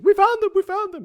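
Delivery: very high, loud triumphant shriek; joyful scream. Retold or imitated. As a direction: scream it out, overjoyed at the find. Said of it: "she's screaming".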